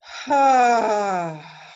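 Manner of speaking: loud, falling "haaa" exhale; big releasing sigh through the mouth; audible sigh. Shown as a demonstration. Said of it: "when you make sound".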